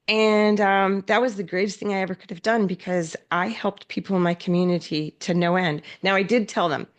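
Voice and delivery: ordinary delivery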